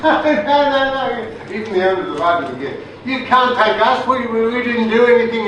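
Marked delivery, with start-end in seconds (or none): 0.0-1.5 mock protest. Aussie soldiers protesting to the MPs, high and pleading. 3.1-5.5 high, pleading denial; mock protest. Soldiers denying blame to the MPs, high and pleading.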